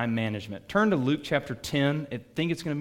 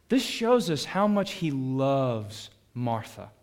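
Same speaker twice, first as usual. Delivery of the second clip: ordinary talk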